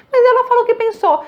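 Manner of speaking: high, loud, fast parent objection; a high, fast mock parent's protest. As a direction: play the indignant parent.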